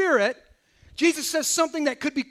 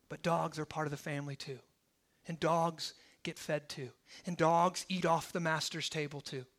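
much softer, low and intimate; hushed retelling; 7 semitones lower, 12 dB quieter than usual. Acted. Preacher retelling Jesus and the woman in a hush. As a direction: draw them in, almost murmured.

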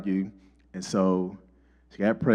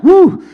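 very high, loud woo of relief; wife cheering at the ultrasound; relieved whoop; 13 dB louder than usual. Retold or imitated. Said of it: "she was like".

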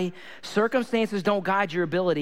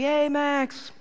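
calling out greeting; 8 semitones higher than usual. Retold or imitated. Dad to neighbor Max at the bus stop, calling out. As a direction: imitate hailing a kid.

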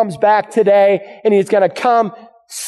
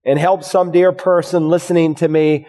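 lower-pitched, earnest pastoral prayer; closing prayer; 4 semitones lower than usual. Real, not acted. Preacher asking God to help the congregation, closing prayer. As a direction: bring the voice down and pray to God.

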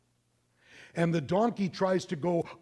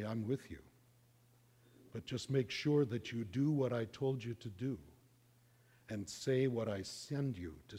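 soft, solemn divine voice; voice of the Lord; 4 semitones lower, 7 dB quieter than usual. Acted. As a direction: speak low and quiet like God. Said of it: "he hears the voice of the Lord".

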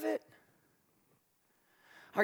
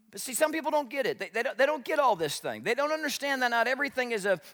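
ordinary delivery

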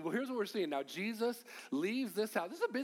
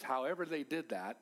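usual speaking voice

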